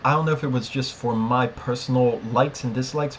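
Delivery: normal talking voice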